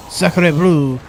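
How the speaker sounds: put-on loud hammy French accent. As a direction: blurt it in a mock French accent. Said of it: "your distaste for the French".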